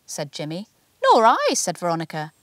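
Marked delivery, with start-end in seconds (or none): 1.0-1.5 much higher, louder, brisk agreement; a colleague chiming in. A narrator's reading. Veronica agreeing with Jimmy, high and brisk.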